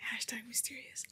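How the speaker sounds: breathy whispered aside. Real, not acted. Whispering a joke to the group.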